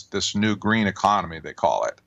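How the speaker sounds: ordinary talk, nothing marked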